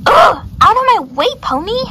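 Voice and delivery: high, loud horse outburst; annoyed yell. Acted. Horse blocked by little pony, loud annoyed yell. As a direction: shove the pony aside with your voice.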